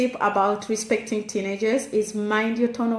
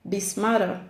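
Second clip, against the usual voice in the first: normal talking voice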